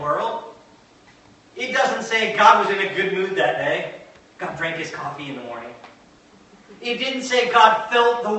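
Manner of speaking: building preacher's list of denials, each rising at the end; emphatic preaching. Real, not acted.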